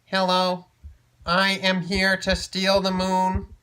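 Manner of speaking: slow, loud robot voice. Acted. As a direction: announce it like a tiny robot. Tiny robot speaks.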